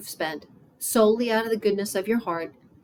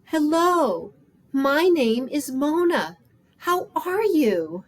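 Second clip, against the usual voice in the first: louder, higher, slow, drawn-out greeting; speaking up; 6 semitones higher, 4 dB louder, slower than usual. Acted. Mona to a homeless woman who didn't hear, speaking up. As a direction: project each word slowly to someone who may not hear. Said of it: "so I spoke up".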